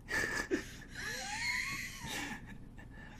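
shrill maniacal cackle; mad scientist laugh. Acted.

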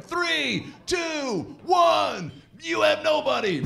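high, shouted countdown to nobody. Pretend. Comic shouting a lonely New Year's countdown, loud and high. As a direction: yell it like the ball is dropping.

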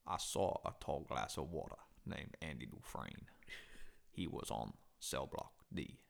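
deep, low Shawshank narrator voice; Morgan Freeman narration. Acted. Reciting the movie to his friend as the deep narrator. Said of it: "you just went into fucking his movie".

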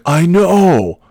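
loud high snapped shout; yelling. Retold or imitated. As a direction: bark it back, offended. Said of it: "you don't have to fucking yell at me".

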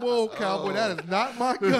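loud, laughing "whoa cowboy"; laughing through a retold protest. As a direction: laugh while denying the gun. Laughing denial to cop.

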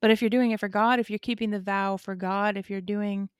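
ordinary talk

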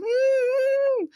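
high, sustained thin whine; imitated dog whimper. Storyteller imitating the stray dog not wanting out, whimpering. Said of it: "She's, like, whimpering".

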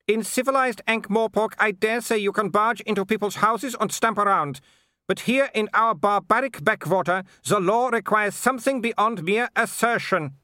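high-pitched imperious aristocrat; scornful Baroness. A narrator's reading. Baroness scorns the threatening visitors, high and haughty. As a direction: look down on them, voice pitched far up.

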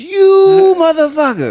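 high, loud, slow teasing taunt; laughing accusation. Retold or imitated. Maintenance guy taunting the teller, laughing, high and loud. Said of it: "he's laughing while he's saying it".

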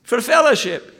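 imitating the Glaswegian pastor's soaring excitement about his church; thrilled Barry. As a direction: climb up into a giddy, breathless report. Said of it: "Then he said".

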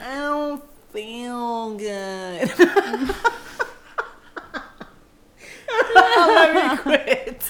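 whimpering, slow, laughing whine; whiny sick man. Pretend. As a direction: whine pitifully through laughter.

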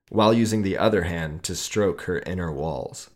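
ordinary delivery, nothing marked

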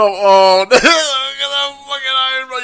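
laughing. Real, not acted. Host cracks up watching the Secret Service agent, laughing. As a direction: laugh through the words at the agent.

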